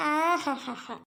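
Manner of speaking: low soft hammed-up lament cry; a sobbing ahaha like a hired mourner; fake wail. (போலி ஓலம்)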